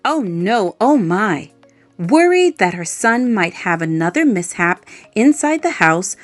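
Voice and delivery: plain reading voice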